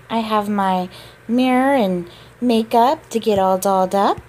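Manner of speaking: ordinary talk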